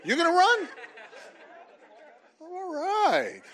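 high, loud laughing surprise; delighted disbelief at a volunteer; surprised laugh. Real, not acted. Speaker to the young volunteer, high laughing surprise.

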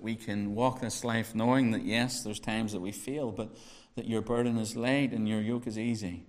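low, soft, unhurried opening prayer. Real, not acted. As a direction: pray quietly over the congregation before preaching. Opening prayer before sermon.

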